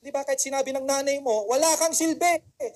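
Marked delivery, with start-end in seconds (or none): 1.5-2.4 mock scolding, loud and higher; mother scolding. Mother telling child he's useless, mock scolding. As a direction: nag like an exasperated mother.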